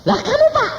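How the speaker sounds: excited high voice. Retold or imitated. The man asks the pastor.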